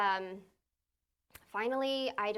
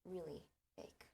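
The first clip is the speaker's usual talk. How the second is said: quiet reproach; 13 dB quieter than usual. Retold or imitated. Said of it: "then he was like".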